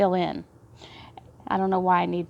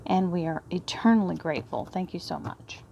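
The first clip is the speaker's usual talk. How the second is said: hushed, reverent prayer voice, dropping softer; 3 semitones lower, 5 dB quieter than usual. Acted. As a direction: murmur thanks to the Divine like a quiet blessing. Closing prayer to the Divine.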